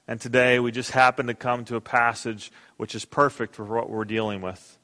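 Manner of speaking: ordinary talk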